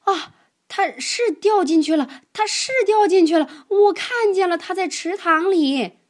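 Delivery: high, loud, excited alarm; alarmed elephant. Acted. An elephant thinks Webb fell into the pond, high alarmed shout. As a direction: blurt it out in a panic.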